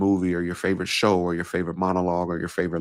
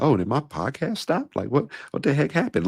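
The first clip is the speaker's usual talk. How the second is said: high puzzled listener; confused; 7 semitones higher than usual. Retold or imitated. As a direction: sound like your podcast just cut out. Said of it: "You're probably like".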